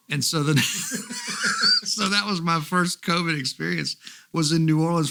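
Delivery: laughs through the words; giggling at his own bad luck; chuckling while telling how he caught covid; laughing. Real, not acted. Laughing about getting covid.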